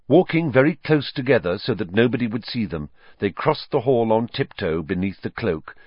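usual speaking voice

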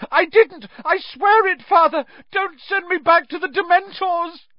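frantic, very high pleading shriek. A narrator's reading. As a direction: beg at the top of your voice. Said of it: "shrieked the boy in chains below".

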